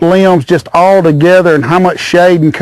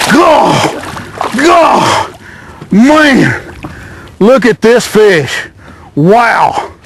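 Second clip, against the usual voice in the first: high, amazed exclamations; real excitement; 5 semitones higher, much slower than usual. Real, not acted. Angler fighting a big crappie, high and excited. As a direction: let each word jump up high in excitement.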